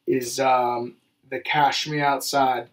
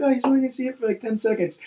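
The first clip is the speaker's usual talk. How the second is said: high, naive mocking girl voice; 9 semitones higher, much faster than usual. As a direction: play a clueless girl, pitched up. Mocking what the girls think.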